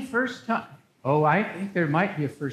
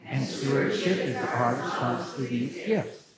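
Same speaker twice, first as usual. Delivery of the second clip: congregation repeats together; group echo, lower and softer; shadowing; 6 semitones lower, 5 dB quieter than usual. Real, not acted. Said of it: "please repeat after me".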